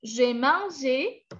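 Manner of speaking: "mangé" said slowly, ending on a full "ay"; slow teaching demo, clear é closing "mangé"; accented final é. Shown as a demonstration. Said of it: "con acento". ("mangé" despacio, con la é final bien marcada)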